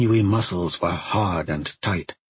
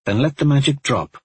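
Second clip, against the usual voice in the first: ordinary delivery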